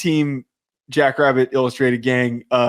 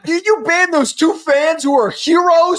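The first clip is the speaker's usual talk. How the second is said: high, pushed voice at the TV; worked-up rant; 12 semitones higher than usual. Real, not acted. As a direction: rail at the screen. Heated rant at TV.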